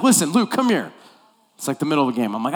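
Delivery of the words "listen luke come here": calling out. Retold or imitated. Coach calling player over.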